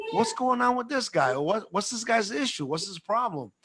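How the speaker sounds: high, mock-bewildered questions; acted puzzled questioning. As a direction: imitate someone baffled by a stranger. [name] questions the passed-out man.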